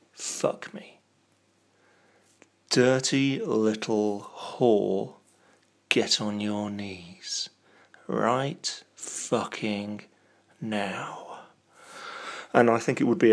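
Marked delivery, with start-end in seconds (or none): 0.1-1.0 soft breathy whisper; hushed plea. Pretend. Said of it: "whispered dirty talk". 2.7-11.4 mock sultry command fading. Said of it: "sultry commanding dirty talk".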